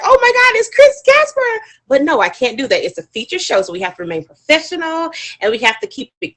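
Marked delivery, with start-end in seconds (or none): 0.0-1.7 loud, high-pitched squeal of recognition; super excited. Acted. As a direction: gush as if a favorite just walked in. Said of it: "super excited, like".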